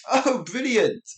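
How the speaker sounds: high, loud delighted exclamation; sincere, high-pitched enthusiasm; genuinely pleased. Shown as a demonstration. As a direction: say it as if it's truly great news. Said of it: "That's great" for the first one".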